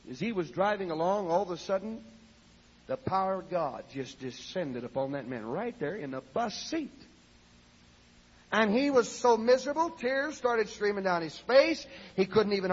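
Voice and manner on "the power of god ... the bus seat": ordinary talk, nothing marked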